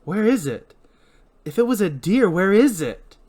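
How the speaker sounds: pressing, agitated questions; demanding challenge. Acted. Julie challenges the deer excuse.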